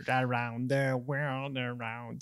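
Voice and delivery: slow robotic sung imitation; vocoder. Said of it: "Tu sais, c'est du vocoder". (voix au vocoder)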